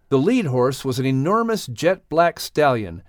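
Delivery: ordinary delivery, nothing marked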